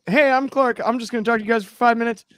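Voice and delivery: rushed then shouted announcer bit; ring announcer. Pretend. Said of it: "Ring announcer?".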